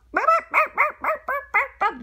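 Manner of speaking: high, loud burping noises; mock burps. The ostrich eating, silly high burps. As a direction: burp it out, cartoon style.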